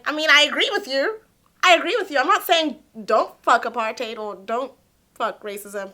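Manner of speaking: normal talking voice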